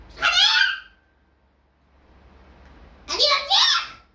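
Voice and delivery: high, loud challenging yell; shout. Real, not acted. Yelling the question back at his friend. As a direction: bark the challenge out. Same words yelled.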